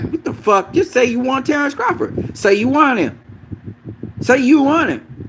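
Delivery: high, exasperated pleading at a fighter who won't commit; exasperated outburst. Retold or imitated. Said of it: "And I'm like".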